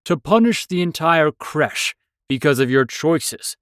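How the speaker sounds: stern drill instructor, calmly reproving; stern old mentor. Acted. [name] continues.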